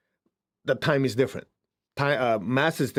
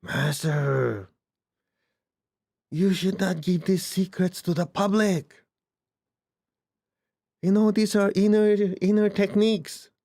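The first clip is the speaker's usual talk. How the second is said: slow, higher, urgent warning to the master; a hint of an alarmed student pleading; stern disciple warning; 6 semitones higher, slower than usual. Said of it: "he goes".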